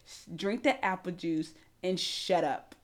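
very low, slow, soft order; hushed, unhurried imitation of a calm man; zen coworker. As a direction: take your time and quietly shut it down. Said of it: "He's very zen".

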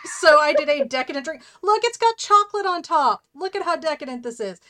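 loud, high, showing off; excited. Real, not acted. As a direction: gush about the drink. Showing off fancy drink.

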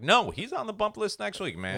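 high exasperated no; mock refusal. Host refusing the drunk comic a spot. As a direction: push him off, high and fed up. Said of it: "you're like".

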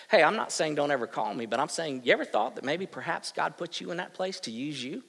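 ordinary delivery

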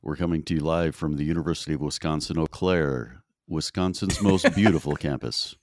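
radio call sign. Shown as a demonstration. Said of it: "can you do your call sign".

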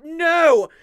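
loud, high no; shouted no. Acted. Raymond's outburst.

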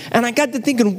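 ordinary talk

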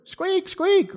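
playful mock squeak; tiny squeak. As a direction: squeak like a timid little bird. Mimicking little bird squeaks.

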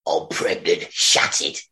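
low, gruff Cockney soap acting; hushed low mock-Cockney drama; EastEnders parody. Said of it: "quick condensed version of EastEnders".

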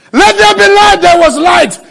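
loud, high shouted proclamation; full-force, high preacher's cry; shouted decree. Real, not acted. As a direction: thunder it out as a creation command. Preacher shouts creation command.

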